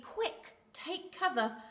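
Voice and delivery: high, urgent turkey warning. Acted. Turkey warns others to hide.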